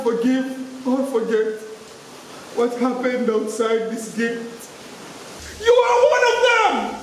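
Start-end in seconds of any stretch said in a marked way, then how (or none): 5.6-7.0 high, loud accusing yell; yelled accusation. Acted. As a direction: hurl it at him as an accusation. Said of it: "she yelled at me".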